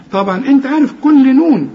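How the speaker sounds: usual speaking voice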